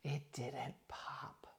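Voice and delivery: slow, hushed, stubborn whisper; mock whisper. Retelling Diana refusing the sauce, slow mock whisper. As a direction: breathe it out slowly like a stubborn secret.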